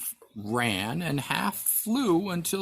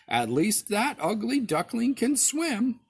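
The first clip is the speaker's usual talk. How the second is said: mother duck voice; 8 semitones higher than usual. A narrator's reading. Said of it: "said the mother".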